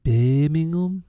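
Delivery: Birmingham accent. Shown as a demonstration. Podcaster having another go at Birmingham, exaggerated accent. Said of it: "a Birmingham accent".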